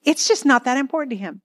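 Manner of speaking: high, aggrieved self-talk rising in pitch; indignant inner voice. Retold or imitated. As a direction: play the wounded spouse fuming to herself. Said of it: "It goes something like this".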